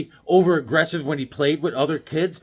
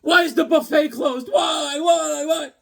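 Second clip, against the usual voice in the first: whining Kingpin; 10 semitones higher, slower than usual. Retold or imitated. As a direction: wail like a big villain denied his buffet. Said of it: "He's like".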